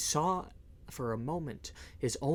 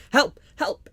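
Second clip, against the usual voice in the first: high, loud panicked cries; crying for help; 11 semitones higher, 10 dB louder than usual. A narrator's reading. Townsfolk cry out in the melee, high panicked yells. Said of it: "some said".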